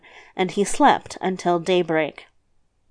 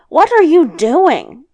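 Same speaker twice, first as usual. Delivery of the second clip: loud, higher, slow indignant question; crying out; 6 semitones higher, 6 dB louder, slower than usual. A narrator's reading. Devil woken by grandmother pulling his hair, crying out. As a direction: raise the voice in protest. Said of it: "cried the devil".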